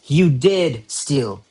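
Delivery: slow, with "did" drawn out and punched; "did" emphasized as an insistent accusation; stress on did. Shown as a demonstration. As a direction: lean hard on "did". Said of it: "we're putting emphasis on that".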